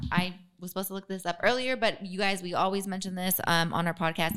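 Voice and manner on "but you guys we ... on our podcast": ordinary delivery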